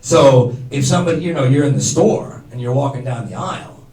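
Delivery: usual speaking voice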